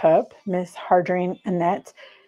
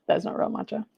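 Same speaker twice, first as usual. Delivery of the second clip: whispered; 7 dB quieter than usual. Real, not acted. Aside repeating the verdict.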